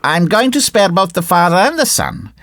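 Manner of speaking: high little insect voice; admonishing cricket. Acted. Cricket spares father and son, high admonishing voice.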